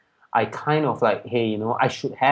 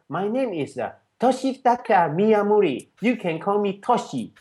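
higher-pitched imitated Japanese accent; Japanese-accented English; 7 semitones higher than usual. Japanese friend introducing himself to a student, imitated Japanese accent. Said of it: "in his kind of English".

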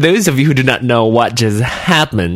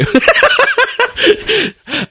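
high giggle. Real, not acted. Giggling over a listener's photo.

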